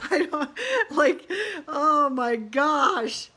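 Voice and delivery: real laughter through the words, words stretched slow; giggling while complaining about the panting dog; laughing. Real, not acted. To her viewers about her nervous dog, laughing.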